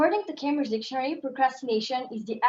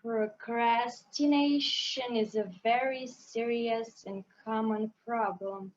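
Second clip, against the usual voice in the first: very slow, dragged-out, softer reading; slow motion; 4 semitones lower, 4 dB quieter, slower than usual. Shown as a demonstration. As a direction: stretch every word as if in slow motion. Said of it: "do it in slow motion".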